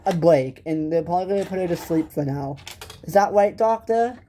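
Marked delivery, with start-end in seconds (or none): none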